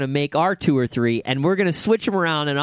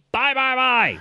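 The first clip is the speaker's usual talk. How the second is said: higher-pitched mock-eager "Buy, buy, buy"; eager buy call; 10 semitones higher, slower than usual. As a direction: hammer "buy" like a frantic trader. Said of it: "I would have been like".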